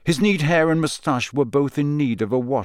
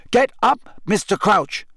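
high, firm, raised voice; loud and clear; 9 semitones higher, 3 dB louder than usual. A narrator's reading. Harry to the man on the ground, loudly and clearly. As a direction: order him up clearly. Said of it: "said Harry loudly and clearly".

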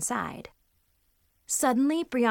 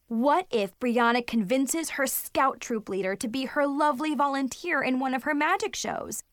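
ordinary delivery

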